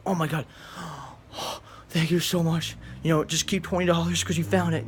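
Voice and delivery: breathless, delighted thanks; gushing gratitude. Pretend. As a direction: act out the grateful reply he should have given. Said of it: "he even say".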